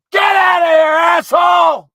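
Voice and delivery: high, loud cursing yell; furious shout. Real, not acted. Man shouting the bird off, high loud furious yell. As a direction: drive the bird away for good.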